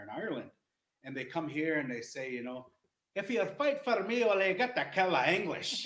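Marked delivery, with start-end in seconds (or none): 3.2-5.9 high, loud mock Irish accent. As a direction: ham up an Irish rebel's Irish accent, raised and loud. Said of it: "they say it in that accent".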